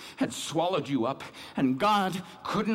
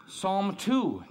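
usual speaking voice

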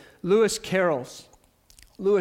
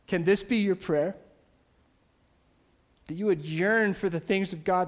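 ordinary talk, nothing marked

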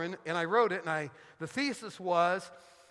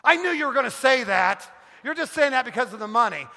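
loud, higher-pitched mock outburst; angry wife; 4 semitones higher, 9 dB louder than usual. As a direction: snap back accusingly as the offended wife. Wife's angry retort, acted by husband.

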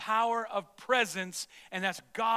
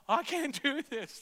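laughing confession; 6 semitones higher than usual. Retold or imitated. New father admits helplessness.

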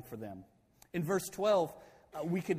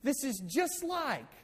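worked-up retold rant, higher pitch; fired up; 5 semitones higher than usual. Moses scolding tribes by recalling the spies, fired up. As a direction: act out a heated Moses, pitched up. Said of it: "he gets really fired up".

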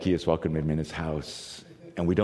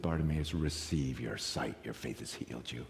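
tender blessing; 8 semitones lower, 10 dB quieter than usual. Retold or imitated. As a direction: hush it like a blessing. Jesus heals softly.

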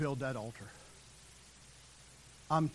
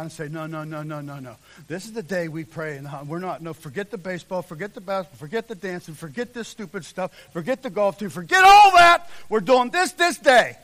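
acted firm refusal; 2 semitones higher, 15 dB louder than usual. Preacher voicing those who should refuse sports on prayer day, building shout. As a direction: build from brisk insistence into a shout.

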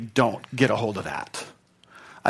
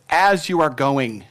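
"As you are going." spelled out slowly; slow clear gloss; slower than usual. Shown as a demonstration. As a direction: give each word weight. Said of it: "what it's actually saying is".

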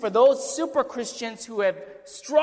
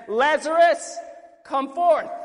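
higher, louder call of Lazarus; commanding call; 6 semitones higher, 6 dB louder, slower than usual. Acted. Preacher voicing Jesus calling Lazarus from the tomb, loud command. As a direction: call him out of the tomb with a raised voice.

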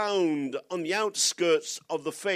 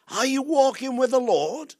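echoing Scottish brogue; 7 semitones higher, 7 dB louder than usual. Retold or imitated. Preacher re-echoes elder's question.

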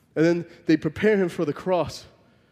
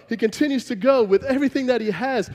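usual speaking voice

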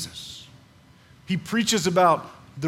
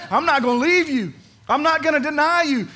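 higher, louder defiant vow; Peter's bold vow; 9 semitones higher, 7 dB louder than usual. Retold or imitated. Peter promising Jesus he won't leave, high and loud. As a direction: swear it like you mean it to the end. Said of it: "That's what he said".

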